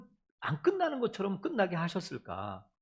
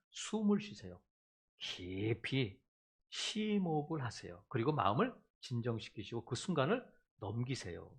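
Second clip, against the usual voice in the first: calming advice; 8 semitones lower, 8 dB quieter than usual. Retold or imitated. Counselor calmly telling an angry person to breathe, soothing. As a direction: talk someone down from anger.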